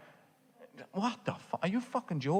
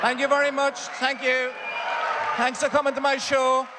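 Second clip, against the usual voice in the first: much higher, louder thanks to the crowd; loud sign-off; 9 semitones higher, 4 dB louder than usual. Real, not acted. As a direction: shout thanks over applause. Sign-off to applause.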